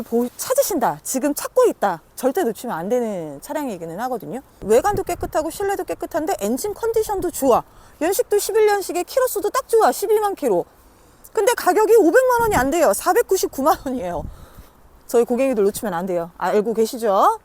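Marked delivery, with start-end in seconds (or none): 4.6-14.2 excited sales pitch. Real, not acted. Building up the bargain price.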